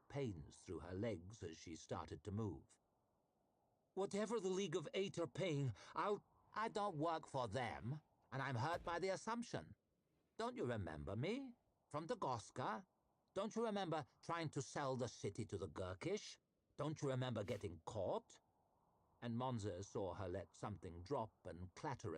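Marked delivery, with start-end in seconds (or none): 3.9-6.2 high, anxious plea; frightened pleading lady. A narrator's reading. Ida bargaining, cut off. 6.5-18.4 questions rising in mock innocence; mock-hurt taunting. A narrator's reading. Vitari mocking questions.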